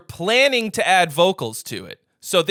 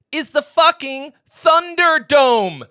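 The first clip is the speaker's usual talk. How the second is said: slow, loud, high announcer roar; hype yell; 10 semitones higher, 7 dB louder, much slower than usual. Real, not acted. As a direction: bellow it like a wrestling promo. Hyping the contest.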